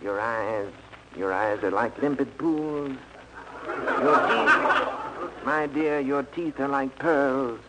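a light, slow crooning matinee idol; lightly hammed-up suave lover, drawn out; mock film romance, slow and soft; Ronald Colman romantic. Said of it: "like Ronald Colman and say".

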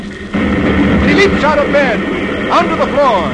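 high, loud, urgent storytelling; breakneck-drama narrator at full voice; excited narration. A narrator's reading. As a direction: call it out over the racket. Narrator building up the racket.

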